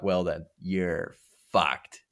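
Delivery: slow, deadpan delivery of the line; slow savoured quote. Retold or imitated. Fan repeating a favourite movie line slowly. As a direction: savour each word of it slowly. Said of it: "my favorite line".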